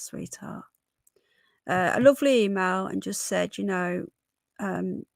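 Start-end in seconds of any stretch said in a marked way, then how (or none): 0.0-0.6 whispered sweetheart. Real, not acted. Tender aside to a chat member.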